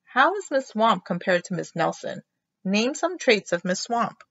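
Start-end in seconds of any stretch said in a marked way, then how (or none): none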